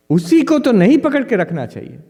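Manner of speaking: excited teacher retort. Retold or imitated. Old sadhu answers the eager monk, imitated excited rebuke. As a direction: fire it back at the young monk. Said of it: "he excitedly, he said that".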